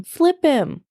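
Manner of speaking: understated high-pitched shouted order; narrated shout. A narrator's reading. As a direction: call it out high, without full volume. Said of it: "started shouting as she swam towards them".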